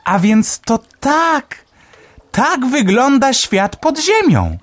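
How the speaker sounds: very high, slow chirpy bird voice; Melek the sparrow. A narrator's reading. Sparrow Melek marvelling underground, high chirpy voice.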